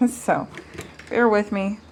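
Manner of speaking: ordinary talk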